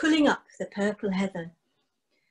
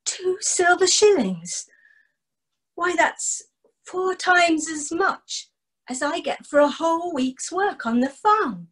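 higher, excited disbelief; amazed; 7 semitones higher than usual. Acted. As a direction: marvel at the fortune. Archie amazed at the money.

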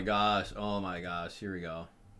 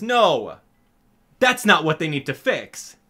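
usual speaking voice

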